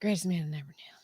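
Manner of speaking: soft whisper, no voice; hushed, whispered reply; whispered vote. Real, not acted. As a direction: whisper your answer.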